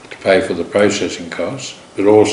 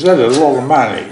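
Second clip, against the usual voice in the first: lightly imitated Italian accent, higher, louder, fast; 6 semitones higher, 6 dB louder, faster than usual. Teller quoting the Italian backer asked for eight million, light Italian accent. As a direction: mimic an Italian backer hearing a huge sum in a light Italian accent. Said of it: "He spoke with quite a pronounced Italian accent".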